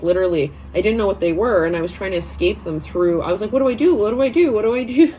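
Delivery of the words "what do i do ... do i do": mock panic. Retelling her panic, high and loud.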